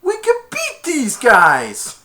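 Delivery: high, loud and slow rallying line; determined. Retold or imitated. Tad rallying the team, slow, high and loud. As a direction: say it like a gruff hero. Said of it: "Tad said".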